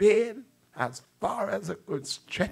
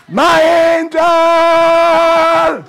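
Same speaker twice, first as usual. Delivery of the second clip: ringing shout; 11 semitones higher, 13 dB louder, much slower than usual. Acted. Preacher echoing God's promise.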